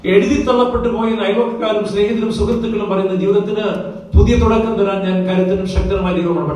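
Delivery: high, loud pulpit proclamation; fervent preacher's cry; full-force preaching. Acted. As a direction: thunder the promise to the congregation.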